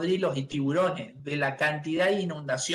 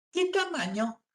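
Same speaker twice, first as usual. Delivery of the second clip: shop clerk question; 11 semitones higher than usual. Retold or imitated. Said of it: "Y dice".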